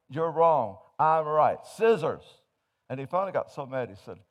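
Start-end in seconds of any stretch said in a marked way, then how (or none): 0.1-2.4 insisting loudly. Acted. Quarrel in joke, wife insists.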